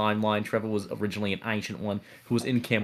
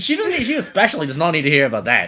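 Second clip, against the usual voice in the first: louder, fast panicked insisting; flustered protest; 7 semitones higher, 8 dB louder, faster than usual. Acted. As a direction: hurry to shut it down.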